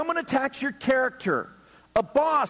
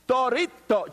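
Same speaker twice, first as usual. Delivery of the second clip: Spanish Doritos; 3 semitones higher than usual. Retold or imitated. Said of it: "how to say Doritos in the proper phonetic Spanish".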